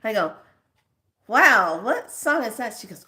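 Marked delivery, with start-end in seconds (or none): 1.3-2.8 bright, louder wow; surprised question to the boss. Retold or imitated. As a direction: react with delighted surprise. Reacting to ringtone.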